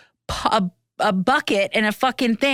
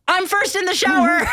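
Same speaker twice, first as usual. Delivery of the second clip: eager mock claim; 11 semitones higher than usual. Racing for the hotel shower.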